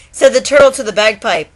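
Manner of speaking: sing-song storytelling read; poem recitation. A narrator's reading. Vlogger reading Shel Silverstein to viewers, rhythmic recitation. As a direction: read it like a bedtime rhyme.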